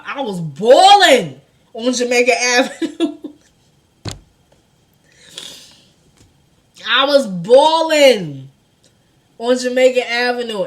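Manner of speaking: loud, amused storytelling outburst; loud exclaiming. Real, not acted. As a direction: belt out the confession, half laughing. Laughs recalling own public crying.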